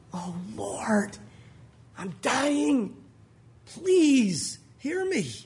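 acted desperate prayer, whispered start building to a drawn-out cry; heartfelt plea. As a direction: plead with God, swelling from a whisper.

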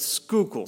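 Schuylkill said SKOO-kul; local pronunciation. Shown as a demonstration. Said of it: "like school, or scuba".

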